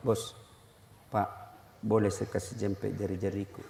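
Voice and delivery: very low, hushed polite asking; discreet request. Pretend. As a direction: murmur it to the person beside you. Acted discreet request to a neighbour.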